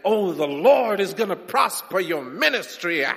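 hammed-up sugary pulpit promise; sweet flattering preacher. Preacher flattering the crowd to keep them coming, mock sweet and loud. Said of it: "Say sweet words to them".

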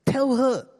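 high, loud, exasperated demand. Acted. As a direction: burst out with a shrieked order. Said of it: "Martha must be raising her voice".

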